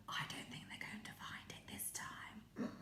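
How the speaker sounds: hushed confiding whisper; whisper aside. Real, not acted. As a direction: whisper to the puppet.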